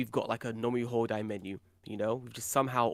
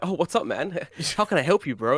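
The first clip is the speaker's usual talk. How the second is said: put-on American accent; 4 semitones higher, 7 dB louder than usual. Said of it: "If I speak in a, like, a American accent".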